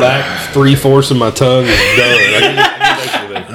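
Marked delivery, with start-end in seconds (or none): 2.2-3.5 laughing. Real, not acted. Burning tongue, laughing in pain.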